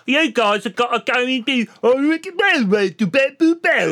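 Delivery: mangled mock British, pitched high; terrible British accent. Mocking an actor's accent to friends, terrible fake British. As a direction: do an absurdly bad British accent, drifting into gibberish. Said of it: "he's got a British accent for some reason".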